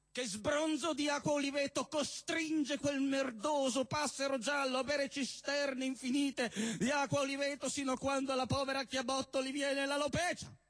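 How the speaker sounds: ordinary talk, nothing marked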